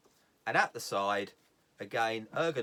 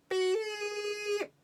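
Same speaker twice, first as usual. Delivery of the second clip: long, very high sustained beep; long electronic beep; 4 dB louder than usual. Retold or imitated. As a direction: squeal out a shrill alarm tone. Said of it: "it will sound like this".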